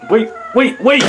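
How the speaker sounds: high, loud plea to stop; urgent calling out. Acted. Calling after someone to stop, urgent shout. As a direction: shout it out fast to halt someone.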